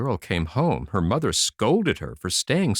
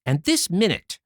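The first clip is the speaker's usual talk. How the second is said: higher, sharp stern order; mother commanding her daughter; scolding mother; 10 semitones higher, 3 dB louder than usual. Acted. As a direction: put the girl in her place.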